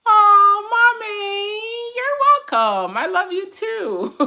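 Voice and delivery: put-on high, slow little-girl "Hi, Mommy" with a teary edge. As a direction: greet mommy like a tiny child. Voicing child thanking her back.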